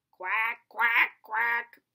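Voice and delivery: low quacking duck noise; duck quacks. Pretend. Imitating a duck.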